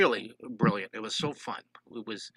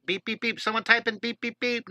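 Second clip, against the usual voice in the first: high chant of beeps; sing-song beep call; 8 semitones higher, 4 dB louder than usual. Real, not acted. Art teacher urging live chat to type beep, high sing-song call.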